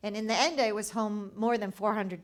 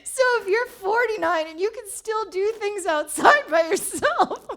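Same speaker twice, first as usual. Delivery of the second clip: higher and louder, words broken by laughter; laughing while talking; 11 semitones higher, 9 dB louder than usual. Real, not acted. Laughing as she tells the host there's hope. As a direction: laugh through the line. Laughs retelling inspiring moment.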